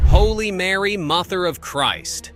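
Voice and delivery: shocked exclamation. Acted. Commentator exclaims at the scandal.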